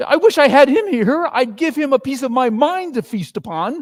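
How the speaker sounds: high, loud furious rant; angry wife. Acted. Missus Cratchit fuming at Scrooge in front of Bob. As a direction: let the anger boil over.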